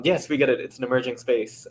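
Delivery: usual speaking voice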